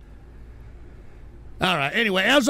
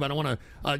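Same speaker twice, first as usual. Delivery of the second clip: normal talking voice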